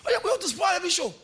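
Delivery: high, loud, fast rebuke; rebuking. Acted. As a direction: scold him fast and high, in disbelief. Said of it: "And started rebuking him".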